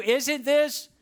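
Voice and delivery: high, loud "Isn't this?" for the crowd to echo; call and response. Shown as a demonstration. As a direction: lead the congregation in repeating "Isn't this?". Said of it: "Say it out loud, a hundred percent of you".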